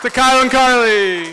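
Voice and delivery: loud, high toast call; rally the whole room; toast shout. Real, not acted. Groomsman toasts the newlyweds to the guests, shouting.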